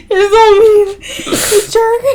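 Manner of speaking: high, tearful laughing protest; helpless laughing. Real, not acted. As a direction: squeal insults through real laughter.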